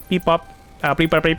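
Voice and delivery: playful beep-bop of a little gadget; imitated computer beeps. Player scanning an item in game, imitated beeping. Voices the computer while playing.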